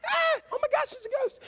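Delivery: high-pitched, hammed-up panic screaming; mock terror, very high voice; cry of fear. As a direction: shriek like you've seen a ghost. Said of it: "they cried out in fear".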